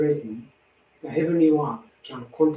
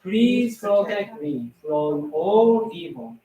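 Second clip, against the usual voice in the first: prayer; 5 semitones higher than usual. Acted. Recites a prayer aloud.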